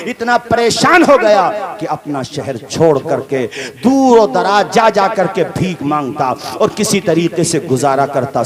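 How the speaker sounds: usual speaking voice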